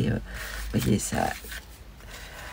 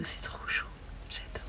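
awed whisper; 11 dB quieter than usual. Real, not acted. Admiring a card.

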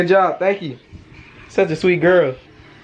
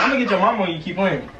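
normal talking voice

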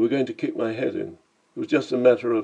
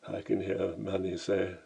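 ordinary delivery

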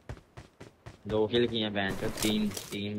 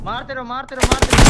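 urgent shouted keep firing; shouting; 9 semitones higher, 10 dB louder than usual. Real, not acted. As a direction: yell at the squad to keep shooting. Urging team in firefight.

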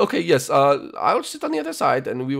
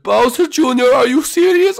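loud, raised-pitch disbelief; aghast exclamation; 12 semitones higher, 8 dB louder than usual. Acted. Chef scolding boy over the date, loud disbelief. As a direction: scold him in loud alarm.

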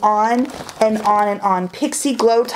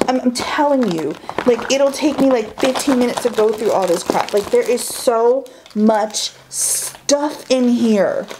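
usual speaking voice

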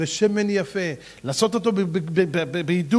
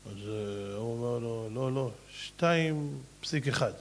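very soft, slow low voice; hushed telling; 7 semitones lower, 8 dB quieter, slower than usual. Acted. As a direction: lean in and murmur. Hushed storytelling quote.

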